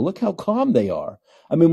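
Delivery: ordinary delivery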